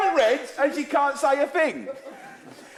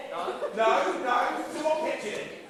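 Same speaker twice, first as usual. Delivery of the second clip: ordinary talk, nothing marked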